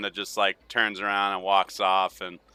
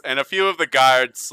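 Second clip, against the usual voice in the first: ordinary delivery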